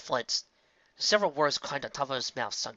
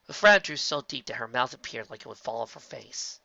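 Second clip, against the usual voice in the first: plain reading voice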